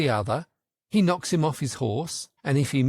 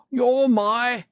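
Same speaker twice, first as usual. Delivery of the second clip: much higher triumphant cry; the Red Knight crying out; 10 semitones higher, 5 dB louder than usual. A narrator's reading. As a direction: proclaim the capture, pitched high. Red Knight starts again, cut off.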